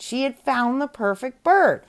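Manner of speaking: usual speaking voice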